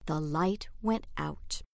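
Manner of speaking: plain reading voice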